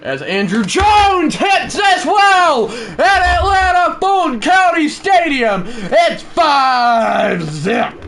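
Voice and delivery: shouted, high, slowed announcer call; home-run call. Acted. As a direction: stretch every word for the home run.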